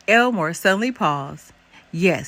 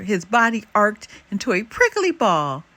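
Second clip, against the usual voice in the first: plain reading voice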